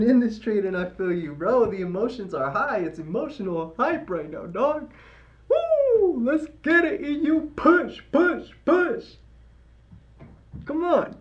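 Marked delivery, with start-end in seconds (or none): 5.5-11.2 cheering. Real, not acted. Cheering the chart on.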